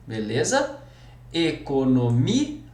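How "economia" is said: "Economia" devagar, com "mi" e "a" separados; hiato separado. Shown as a demonstration. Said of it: "nós temos hiato". ("Economia" escandida, destacando o hiato i-a)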